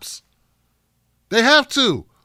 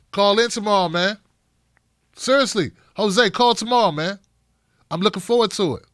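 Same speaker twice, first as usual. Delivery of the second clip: ordinary talk, nothing marked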